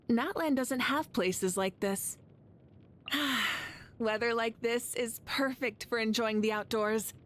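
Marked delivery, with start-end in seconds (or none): 3.1-3.7 a long, easy sigh of contentment; relaxed sigh. Acted. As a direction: breathe out, enjoying the cool air. Contented sigh in cool weather.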